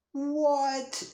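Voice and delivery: mock stunned Vin Diesel what; baffled what. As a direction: blurt it out, totally baffled. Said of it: "Vin Diesel's like".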